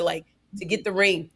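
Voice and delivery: usual speaking voice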